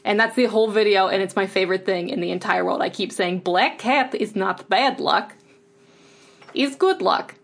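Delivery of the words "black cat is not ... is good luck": put-on slow Russian-accented quote, pitch lifting on good. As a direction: repeat the Russian catchphrase fondly. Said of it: "one of the few accents I can kind of do".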